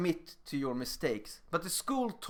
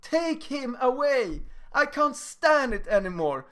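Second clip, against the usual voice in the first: high, slow whimpering plea; whiny crying kid; 10 semitones higher, 4 dB louder, slower than usual. Retold or imitated. A big kid whines to the teacher, high tearful plea. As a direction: beg the teacher like a big kid close to tears. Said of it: "He starts to cry to the teacher".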